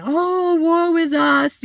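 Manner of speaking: high, loud, drawn-out lament; hammed-up self-pitying wail; mock woe. Mother mocking parents who complain, mock woe. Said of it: "I don't be like".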